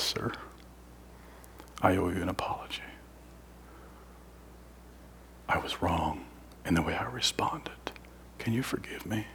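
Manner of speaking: soft, humble whisper, low; whispered apology. Retold or imitated. Officer apologizing to the prisoner at his cell, whispered. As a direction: breathe the apology, barely voiced.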